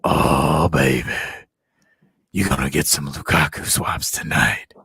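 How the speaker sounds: very low, loud mock seducer; hammed-up deep, sultry voice; put-on deep voice. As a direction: croon it deep into the microphone. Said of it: "did that voice".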